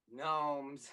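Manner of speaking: low, hushed mock-profound voice; one word delivered like deep poetry; poetic friend. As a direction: drop low and soft to imitate him. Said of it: "he's, like, poetic and stuff".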